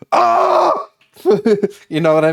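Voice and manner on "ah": sudden high, loud confrontational "Ah!"; mock fighting yell. Reacting to a stranger's comment, with a high, loud mock fighting yell. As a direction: bark a quick ready-to-fight yell. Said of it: "my brain was so wired to be like".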